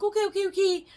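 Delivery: high, rushed frightened okays; imitating her scared self agreeing; scared compliance. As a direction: babble agreement out of fear. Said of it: "I'm like".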